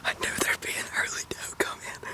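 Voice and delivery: hushed hunter's whisper. Real, not acted. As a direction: whisper it low in the woods. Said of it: "I don't even know I'm whispering".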